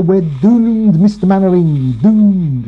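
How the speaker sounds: lightly voiced Scottish doom-monger; mock gloomy Scottish warning; a hint of a Scottish accent, gloomy; Dad's Army Scottish. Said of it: "it's not a very good impersonation of him".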